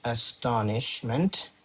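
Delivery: "astonishment" drawn out quietly; slow dictation. Shown as a demonstration. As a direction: spell out "astonishment" by sound while writing. Dictating the word while writing.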